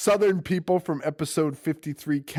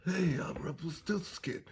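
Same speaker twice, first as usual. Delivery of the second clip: hushed, whispery strangled impression; soft voiceless croak, like a blocked throat; Rumpelstiltskin; 3 semitones higher, 9 dB quieter than usual. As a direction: rasp it out with barely any voice. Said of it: "then he goes back to like".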